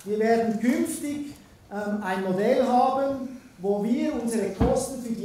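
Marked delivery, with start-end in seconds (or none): none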